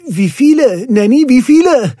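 high, loud, urgent questioning; eager pestering. A narrator's reading. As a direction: pester her excitedly for the answer. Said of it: "löcherte Roger sie". (aufgeregt drängend)

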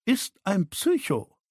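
drawn-out, high-pitched verdict; measured, pointed contempt; slow and deliberate. A narrator's reading. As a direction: spell it out slowly with disdain. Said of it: "sagte sie langsam".

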